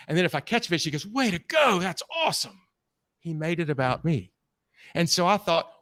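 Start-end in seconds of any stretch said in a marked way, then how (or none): 1.1-2.6 high, excited praise; cheering. Retold or imitated. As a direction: cheer them on like a proud guide. Guide cheering a catch.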